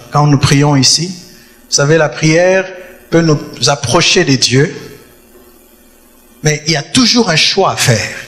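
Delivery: usual speaking voice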